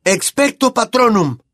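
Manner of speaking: high, forceful spell cry; shouted spell. A narrator's reading. Harry shouting the spell against the screams. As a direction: fight back with the spell. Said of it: "gritó Harry".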